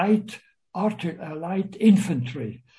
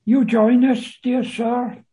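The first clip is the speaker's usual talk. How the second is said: high, loud mock courtesy; raised voice, putting on politeness; mockingly polite; 6 semitones higher, 7 dB louder than usual. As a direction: invite him with exaggerated, teasing politeness. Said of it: "in a kind of mockingly polite way, say".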